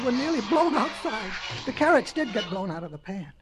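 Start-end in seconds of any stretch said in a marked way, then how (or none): none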